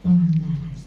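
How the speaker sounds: normal talking voice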